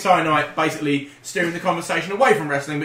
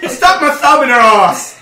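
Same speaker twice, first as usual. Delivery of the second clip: loud, slow, laughing blurt; shouted punchline with a laugh; 6 semitones higher, 10 dB louder, slower than usual. Pretend.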